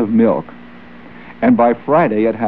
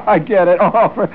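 high, laughing "I get it"; delighted laugh through the words; laughing realization; 9 semitones higher than usual. Real, not acted. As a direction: crack up as the pun lands. Getting the pun, laughing.

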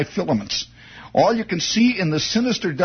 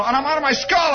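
ordinary talk, nothing marked

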